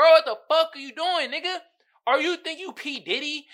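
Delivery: loud, high outraged yell at the album. Real, not acted. Vlogger ranting at [name]'s album, loud outraged yell. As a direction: yell it in disbelief.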